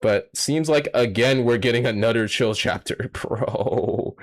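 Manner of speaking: normal talking voice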